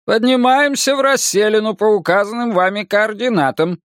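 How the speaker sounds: high, even starship computer voice; spaceship. Acted. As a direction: announce like the ship's AI. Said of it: "раздался голос корабля".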